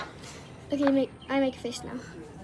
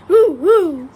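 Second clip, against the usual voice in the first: playful hooting; 11 dB louder than usual. Pretend. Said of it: "you make the face, I make the sound".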